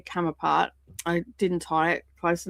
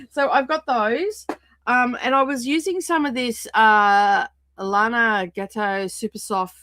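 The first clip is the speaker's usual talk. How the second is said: ordinary talk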